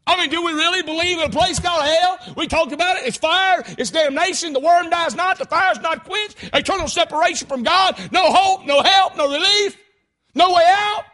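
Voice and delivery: high, loud hellfire preaching; full-force preaching. Real, not acted. Preacher warning the congregation about hell, full-force preaching. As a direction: hammer each phrase out to the crowd.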